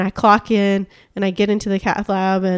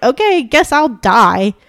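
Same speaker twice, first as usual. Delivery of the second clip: drawn-out, higher and louder, hammed-up resignation; resigned mock quote; 4 semitones higher, 7 dB louder, slower than usual. Mocking a patient who can't afford care, hammed-up resignation. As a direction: play the hopeless patient shrugging it off slowly.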